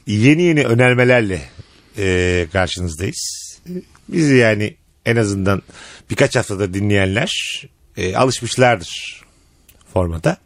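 low, slow solemn presenter; deep pitch shifted. Pretend. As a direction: drag it out, deep and grave. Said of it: "Niye böyle tebareki gibi bir ses tonu var?".

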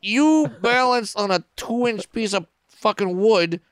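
high, loud, astonished rant; incredulous outburst. Retold or imitated. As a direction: yell your amazement at a strongman. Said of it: "And I'm like".

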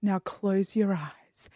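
slow, hushed whisper. Retold or imitated. Performer instructing the audience, slow whisper. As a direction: breathe it out slowly like a hypnotist. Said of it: "he's like".

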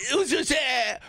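high mock-French tourist voice; French accent. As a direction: plead it like a tipsy Frenchman. Playing drunk French tourist in Milwaukee.

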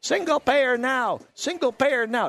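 high, slow chanted protest yell; imitating a protester's shouted slogan; mock yell. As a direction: belt it like a protester. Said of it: "and she yelled".

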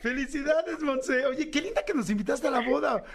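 very high, thrilled squeal of greeting; excited squeal. Real, not acted. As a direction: shriek with joy at hearing her friend. Greeting the bride on phone.

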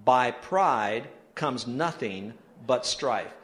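very slow, spaced-out recitation; read aloud together. Real, not acted. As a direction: read it slowly so everyone can join in. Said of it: "Would you read it again?".

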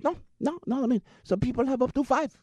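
high-pitched mock Pakistani accent. Driver explaining marriage customs, in a mock Pakistani accent.

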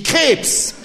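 high, loud blurted guess; a child blurting out the answer; eager shout. Acted. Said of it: "rief ich".

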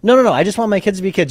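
a parent pushing back hard and quickly; insistent parent. Retold or imitated. Parent cutting off school board's question, fast insistent. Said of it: "all these parents say".

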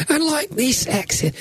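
high, slow dramatic proclamation; hammed-up epic line; mock epic narrator. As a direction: declaim it like a Bible film.

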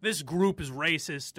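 normal talking voice

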